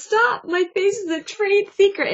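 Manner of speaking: high-pitched retold plea, mock distress; imitated tearful objection in a high voice; mock tearful protest. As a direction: act out her upset protest, pitched well up. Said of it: "And she's crying".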